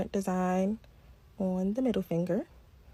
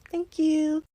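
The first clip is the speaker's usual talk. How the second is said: higher, louder thanks; bright sign-off; 7 semitones higher, 5 dB louder than usual. Real, not acted. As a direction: sing the thank you out. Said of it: "sign-off".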